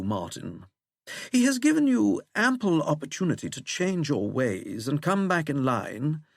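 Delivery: leisurely, swooping plummy English voice; plummy English accent. A narrator's reading. Withering passing on his employer's displeasure, plummy English accent. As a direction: deliver the reprimand in silky plummy English. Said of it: "in that plummy English accent".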